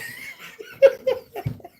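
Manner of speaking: squealing high laugh of disbelief. Real, not acted. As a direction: let out a high-pitched incredulous laugh. Reacting to AI picture.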